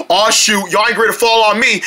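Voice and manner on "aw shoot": usual speaking voice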